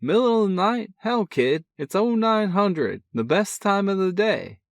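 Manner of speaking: a cocky big brother, full of energy; brash older brother. A narrator's reading. Older brother teasing on the phone, brash and cheerful.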